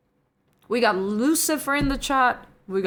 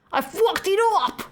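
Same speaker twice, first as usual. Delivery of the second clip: high-pitched mock British accent; 11 semitones higher, 4 dB louder than usual. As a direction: put on a squeaky British accent. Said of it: "British accent".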